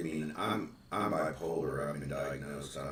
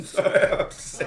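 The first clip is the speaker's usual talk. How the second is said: laughing; 17 dB louder, slower than usual. Real, not acted. Laughing after a joke.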